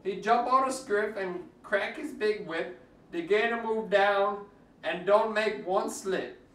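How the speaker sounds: ordinary delivery, nothing marked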